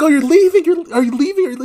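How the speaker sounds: high, loud questioning; imitating panicked passengers. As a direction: play alarmed people pleading for answers.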